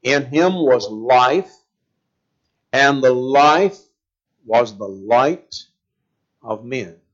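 slow, weighty scripture reading; slow deliberate reading. Shown as a demonstration. Preacher reading the verse to the congregation, slowly. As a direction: let each phrase land slowly. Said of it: "I want you to focus on that".

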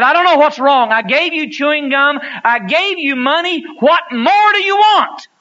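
angry father. Retold or imitated. Father who just slapped his son, shouting in exasperation. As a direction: yell at the crying boy in frustration. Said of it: "And the father was angry now".

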